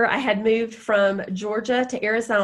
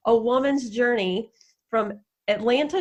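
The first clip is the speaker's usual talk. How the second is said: ordinary talk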